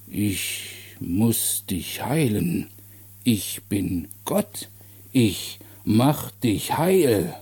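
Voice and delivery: langsam, gedehnt und betroffen; grübelnder kindlicher Mann. Acted. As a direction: staunend langsam vor sich hin sinnieren. Der Kranke spricht zum Erzähler. (langsam und ernst mitfühlend)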